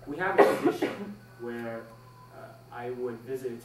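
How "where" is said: ordinary talk, nothing marked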